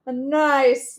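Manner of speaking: ordinary talk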